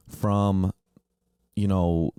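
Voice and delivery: normal talking voice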